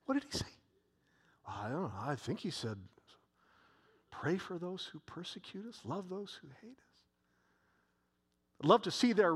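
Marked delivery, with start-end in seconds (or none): 0.1-0.5 higher, puzzled voice of a man in the crowd; a bystander asking his neighbour, lightly voiced; confused listener. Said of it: "One guy says". 1.5-7.0 a neighbour murmuring back, much softer; unsure bystander. Retold or imitated. Second man answers, half-heard.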